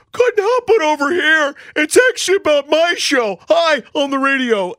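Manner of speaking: loud, high, drawn-out mock announcement; hammed-up eager butting-in. Host imagines telling the dads about his show, hammed-up loud butting-in. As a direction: proudly holler it across the schoolyard, overdone. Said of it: "Do I saunter over there and go".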